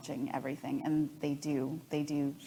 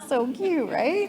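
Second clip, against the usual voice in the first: high cooing "aw", rising up at the end; 10 semitones higher, 3 dB louder than usual. Retold or imitated. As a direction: coo at your son's sweet gesture. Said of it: "And I was like".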